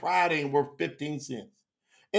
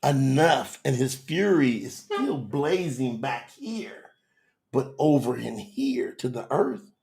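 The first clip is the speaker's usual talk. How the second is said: normal talking voice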